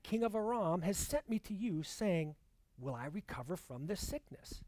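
normal talking voice

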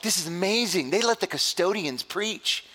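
high-pitched mock amazement; amazed guests. Guests see the supposed custodian preaching, high-pitched amazement.

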